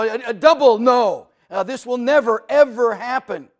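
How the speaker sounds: preacher pressing the lost to come to God, preaching at full force. Acted. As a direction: voice rising loud and high with conviction. Preaching emphatic negation.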